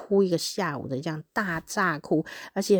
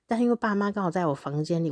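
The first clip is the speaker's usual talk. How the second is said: ordinary delivery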